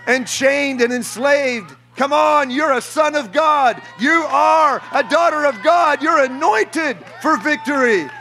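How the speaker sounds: high, shouted preacher's cry over music; fiery revival preacher declaring deliverance; preaching at full force. Acted. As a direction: proclaim freedom over the crowd, full voice.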